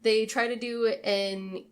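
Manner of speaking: normal talking voice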